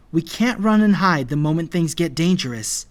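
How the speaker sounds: forceful protest; insistent argument. A narrator's reading. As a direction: urge them to stand firm. Arguing against running away.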